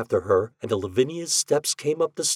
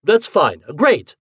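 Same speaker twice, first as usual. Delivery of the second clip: high, loud false enthusiasm; over-eager agreement; 6 semitones higher, 9 dB louder, slower than usual. Acted. Husband distracts wife from the ghost, over-eagerly agreeing. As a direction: cheer her on too heartily.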